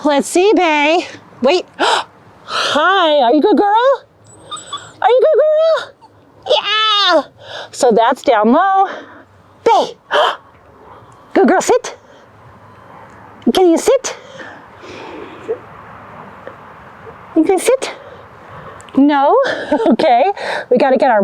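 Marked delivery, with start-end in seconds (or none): 1.4-7.2 talking to a dog. Real, not acted. Cooing at dog during shoot. 9.6-19.4 high, coaxing pet voice; talking to a dog. Real, not acted.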